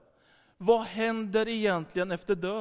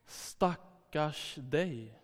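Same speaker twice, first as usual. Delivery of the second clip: very slow, low, soft commiseration; drawn-out, hushed mock sympathy; retold pity; 4 semitones lower, 7 dB quieter, much slower than usual. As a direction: imitate someone pitying, stretching each word. Retold girl pities the priest.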